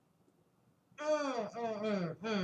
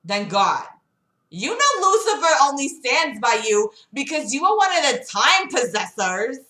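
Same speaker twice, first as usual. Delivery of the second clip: higher, louder female voice; woman's voice; 7 semitones higher, 5 dB louder than usual. Acted. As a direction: confront Andrew as Gamora. Gamora confronting Andrew.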